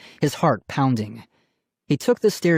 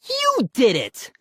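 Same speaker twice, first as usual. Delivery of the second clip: high, drawn-out accusing scream; 13 semitones higher than usual. A narrator's reading. Brother blaming his sister, screaming. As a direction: scream the blame at her. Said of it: "Ian screamed at his sister".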